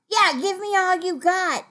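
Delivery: high, forceful mock-rude grab; rude demand. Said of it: "That would be rude".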